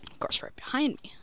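fast, unvoiced whisper. Real, not acted. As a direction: whisper it quickly, resigned. Enemy sneaks up behind.

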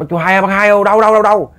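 high, insistent call across the water; urgent calling out. Retold or imitated. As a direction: hail him and press him for where. Rescuers calling out to the man. (gọi với, giọng cao dồn dập)

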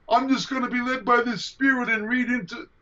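high-pitched mock of a naive believer. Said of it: "This idiotic notion that some Christians".

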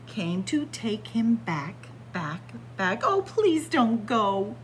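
ordinary delivery

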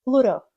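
loud "Pluto" said "Pluro"; American flap. Shown as a demonstration. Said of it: "Американці кажуть оце Т часто як р".

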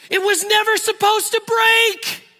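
high, loud, indignant wail; mock indignant whine; whiny car owner. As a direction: play the outraged owner. Upset owner explaining.

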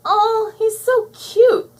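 very high, loud, drawn-out coo; gushing squeal. A narrator's reading. Bubbles gushing over the baby monster, squealing. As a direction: squeal over something adorable. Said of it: "squealed Bubbles".

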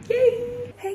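high, loud happy cheer. Real, not acted. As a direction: cheer it out with joy. Cheering about going home.

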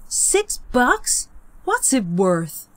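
put-on high, slow sneering kid voice; scornful boy. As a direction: scoff at the money like a bratty teen. Peter voiced in story.

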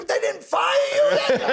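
high, drawn-out incredulous yell; mock outrage. Punchline yelled at the kid.

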